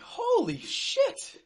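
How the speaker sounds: slow, high, shouted holy shit. Real, not acted. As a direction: scream it at the screen. Reacting to the clip.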